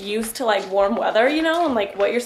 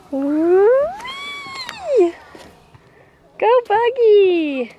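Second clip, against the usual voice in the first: excited cheering; 11 semitones higher, 5 dB louder, much slower than usual. Real, not acted. Mom cheering toddler on swing.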